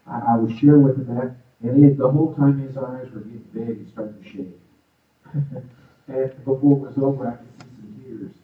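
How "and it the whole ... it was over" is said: ordinary talk, nothing marked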